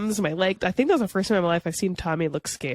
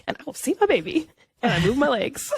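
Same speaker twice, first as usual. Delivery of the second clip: firm, a touch higher; determined mother; 6 semitones higher than usual. Retold or imitated. New mother telling the nurse she will see her baby, determined. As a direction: insist with mama strength. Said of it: "with all the mama strength in me".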